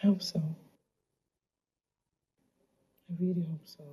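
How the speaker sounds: soft, fading whispered hope; hushed whisper. Acted. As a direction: whisper it, almost to yourself. Hopeless wish after abuse talk.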